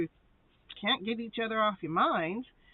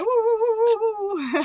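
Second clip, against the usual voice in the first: string of high, loud woos; excited whooping; 12 semitones higher, 8 dB louder than usual. Real, not acted. Reader cheering everyone getting together, excited whooping. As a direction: whoop it up in quick bursts.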